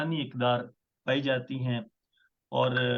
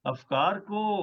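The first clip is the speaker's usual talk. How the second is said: measured, stately poetry recital; ghazal recitation; 7 semitones higher, slower than usual. Acted. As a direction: recite each line with weight. Poet recites his ghazal.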